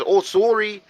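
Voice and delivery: a hint of Canadian, sorry said SORE-ee; Canadian apology. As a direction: apologize like a sitcom Canadian, lifting the voice. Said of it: "he goes".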